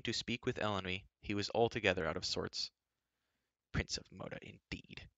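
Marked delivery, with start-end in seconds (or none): 3.7-5.1 sullen muttering. A narrator's reading. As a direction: mutter it under your breath, out of sorts. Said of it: "he muttered".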